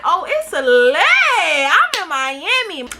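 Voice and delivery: giddy laughing brag; laughing with glee. Retold or imitated. Excited quote of self in Miami.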